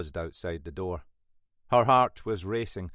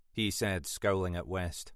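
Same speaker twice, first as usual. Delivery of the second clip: plain reading voice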